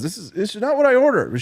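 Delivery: mock indignant Paula. Paula argues with cashier in story.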